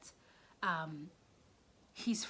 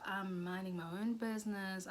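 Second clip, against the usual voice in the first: ordinary talk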